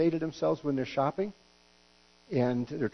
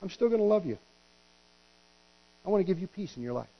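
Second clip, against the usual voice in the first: reassuring God voice; 7 semitones higher than usual. Retold or imitated. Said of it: "God says".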